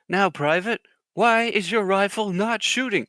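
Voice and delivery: loud, high-pitched mock sergeant demand; drill sergeant. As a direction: imitate a drill sergeant grilling a recruit. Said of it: "the drill sergeant's like".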